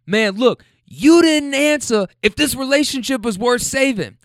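high, loud yelling, imitating both sides; rapid-fire mock argument. Couple shouting over a stranger hearing them, rapid-fire imitated yelling. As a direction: bounce between two hollering partners. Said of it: "I'm gonna go rapid fire now".

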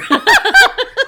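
high, loud burst of laughter. Real, not acted. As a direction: crack up laughing. Laughing at her own joke.

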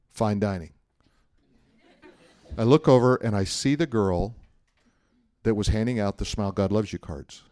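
ordinary delivery

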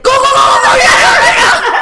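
very high, loud getaway yell; urgent shout. Retold or imitated. Passenger urging the cab driver to flee, shrieked laughing.

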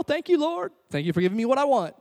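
high, sing-out mock thanks; mock gratitude. Pray-er thanks God like a vending machine, mock gratitude.

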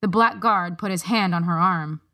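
plain reading voice

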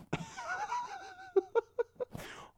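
high-pitched burst of laughter; laughing at the fanfic. Real, not acted. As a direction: crack up at the line. Laughing at the story.

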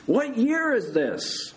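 slow, high shouted question. A narrator's reading. Big Bird tests patient's orientation, shouting. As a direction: quiz a patient at full volume. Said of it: "shouted the correct answer back".

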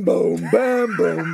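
loud booming drum sounds; imitated timpani booms. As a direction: boom like kettle drums. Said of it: "I'd buy timpani drums and boom".

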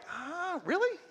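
very high, soft gasp of surprise; surprised. Retold or imitated. Waitress reacting with surprise, retold.

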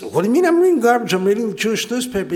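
high, fast, rattled-off comeback; indignant protest. Acted. Moshe defends his newspaper to Chaim, indignant and fast. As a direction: burst out in a high, hurried protest.

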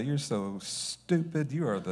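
mock-affectionate dog talk; sweet pet-talk. Shown as a demonstration. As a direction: coo the insult slowly, as if praising a pet. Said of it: "with the right tone of voice".

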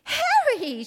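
high startled gasp of a name. A narrator's reading. Dorothy sees battered Harry at the door, gasping. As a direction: catch your breath on his name. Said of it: "she gasped".

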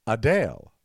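Adele said as uh-DALE; corrected pronunciation. Shown as a demonstration. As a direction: say Adele rhyming with pale. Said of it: "they said".

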